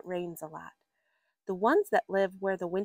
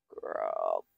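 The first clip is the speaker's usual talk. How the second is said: deep growl; 6 dB quieter than usual. Acted. Reading frog noises aloud.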